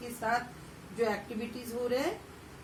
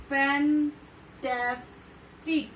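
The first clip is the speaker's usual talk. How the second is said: slow drill; much slower than usual. Shown as a demonstration. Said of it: "repeat करिए".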